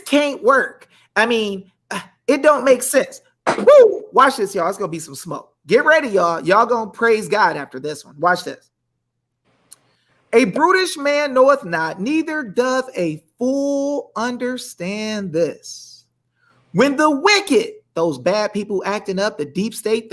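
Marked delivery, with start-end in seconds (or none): 3.6-4.0 high, loud joyful whoop; excited whoop. Real, not acted. Host whooping to viewers after a verse. Preacher exulting mid-sermon. 10.3-17.7 slow, higher preacher reading; preaching scripture. Acted. Host preaching psalm to viewers, slow and lifted. Reads verse preacher style.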